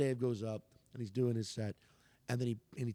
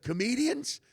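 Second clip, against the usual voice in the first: calling out; 8 semitones higher, 5 dB louder than usual. Retold or imitated. Chappelle calling out comedians.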